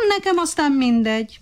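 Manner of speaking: high, smug indifference; condescending monkey in a lifted voice; superior, smiling. A narrator's reading. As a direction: shrug it off with a lofty smile. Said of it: "mondta fölényes mosollyal a majom".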